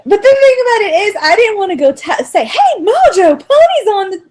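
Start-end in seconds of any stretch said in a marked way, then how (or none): 2.5-4.2 high, bright-pitched mock call to someone. Didn't want to tell Mojo his song was on, mock calling out. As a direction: pretend to call him over, pitched up.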